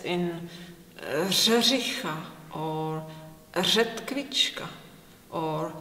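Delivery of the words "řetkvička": Řetkvička with a buzzing ř, slow; slow Czech ř demo. Shown as a demonstration. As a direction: buzz the ř in Řetkvička, slowly. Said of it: "the famous r".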